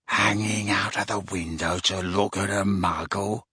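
low, soft menacing question; quiet. A narrator's reading. Gaunt learning of daughter's interest, ominously quiet. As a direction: repeat it quietly, dangerously. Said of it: "said Gaunt quietly".

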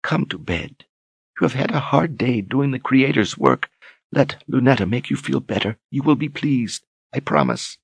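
soft, high seductive coo. Acted. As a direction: coax him sweetly to bed. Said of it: "she cooed intimately".

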